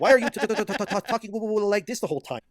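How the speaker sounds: high, loud mock stammer on every t; mocking stutter. Mocking the streamer's trembling voice with a stammer. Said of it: "why is this guy's voice trembling".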